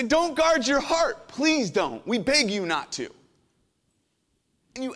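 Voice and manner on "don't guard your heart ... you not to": higher and louder, slow on guard your heart; impassioned plea. Real, not acted. Pastor urging the congregation not to guard hearts, impassioned. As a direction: cry it out as a real plea.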